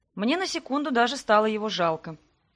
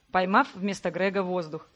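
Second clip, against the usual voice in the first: usual speaking voice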